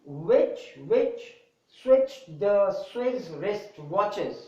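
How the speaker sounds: slow, careful, word by word; slow deliberate tongue twister reading; slow tongue twister demo. Shown as a demonstration. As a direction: spell out each word slowly for students. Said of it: "I have done in a small, in a slow rhythm".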